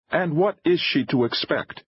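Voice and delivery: high-pitched, challenging question; indignant challenge; demanding. A narrator's reading. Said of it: "Denham demanded".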